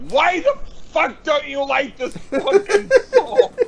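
high, loud, laughing mock outrage; mock furious. To a friend who hated the song, a laughing mock angry yell. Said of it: "I was like".

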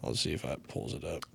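normal talking voice

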